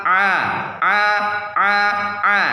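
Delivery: A squeezed from a tight mid throat; ain demo. Shown as a demonstration. Teacher demonstrating the letter ain with a tight, squeezed throat A. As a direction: strain the A through a narrowed middle of the throat. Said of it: "sama seperti H".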